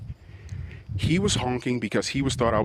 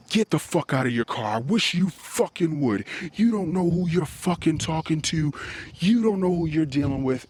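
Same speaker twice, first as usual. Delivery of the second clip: higher, pressing, aggressive threats; retold threatening outburst; 6 semitones higher than usual. Driver confronting the man at his car window, retold aggressive threat. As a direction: square up and dare him. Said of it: "And I said".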